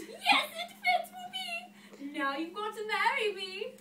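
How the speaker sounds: high, loud, drawn-out shriek; hammed-up screeching glee; screaming. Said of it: "But she screamed".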